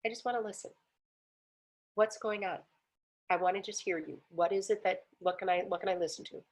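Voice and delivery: soft, unhurried acted reassurance; calm, gentle listening. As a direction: speak softly to let them open up. Retold calm approach to upset woman.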